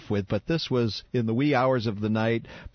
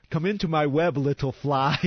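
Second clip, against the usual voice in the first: playful spider luring a fly, a touch higher; mock spider host; 4 semitones higher than usual. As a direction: tease the guest like a spider inviting its prey. Welcoming guest as spider.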